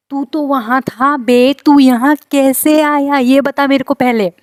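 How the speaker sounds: put-on a girl shouting down a stranger in her hotel room; angry confrontation. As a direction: shout at him like a furious roommate. Retold quarrel with hotel roommate.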